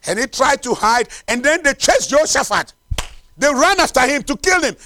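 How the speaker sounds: loud, high-pitched chase story; dramatic preaching. Real, not acted. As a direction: drive the story on urgently.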